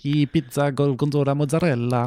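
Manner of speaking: hammed-up Italian accent. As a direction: roll out the words like a cartoon Italian. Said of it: "faire mon petit accent italien aussi".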